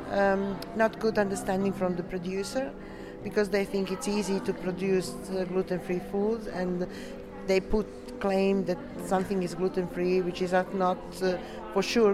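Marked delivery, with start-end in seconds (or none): none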